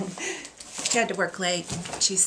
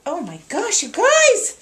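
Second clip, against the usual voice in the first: excited squeal; 9 semitones higher, 10 dB louder than usual. Real, not acted. Unwrapping a handmade quilt gift.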